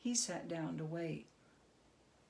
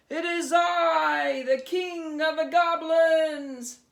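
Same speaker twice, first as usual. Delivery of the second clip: much higher, very loud, slow proclamation; roaring goblin king; 9 semitones higher, 14 dB louder, slower than usual. Acted. Goblin king declaring himself to Herschel, roaring. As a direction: proclaim yourself like a monster king.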